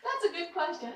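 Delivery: high, loud announcement; loud call. Real, not acted. Speaker answering the audience, loud and high. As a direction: call it out to the room.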